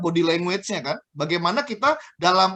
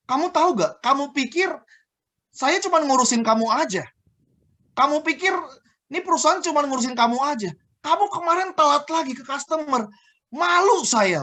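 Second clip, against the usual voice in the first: higher-pitched, indignant telling-off; scolding manager; 7 semitones higher than usual. Acted. Manager scolding a staff member for lateness, scolding, raised pitch. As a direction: chew out an employee with pointed rhetorical questions. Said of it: "Si manajer negurnya begini".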